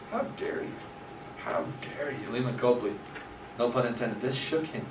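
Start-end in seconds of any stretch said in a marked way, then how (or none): 0.1-2.3 hushed mock indignation. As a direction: let the outrage sink to a near-whisper. Acted rebuke of Copley, voice drops.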